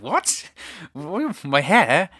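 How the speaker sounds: high, baffled what-what; stunned by the question, voice jumping up; mock incredulous squeak; shocked disbelief. Shocked retold reaction.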